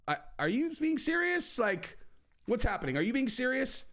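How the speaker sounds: high, incredulous questioning; mock disbelief. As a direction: act baffled, pitch jumping up. Incredulous reply to Nate.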